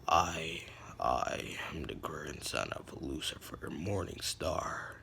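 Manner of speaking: very low, slow, softened character voice; dark, hushed low register. Acted. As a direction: drop deep and brood over each word. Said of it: "his voice got deeper".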